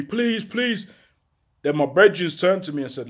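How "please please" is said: begging. Retold or imitated. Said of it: "my man's begging me".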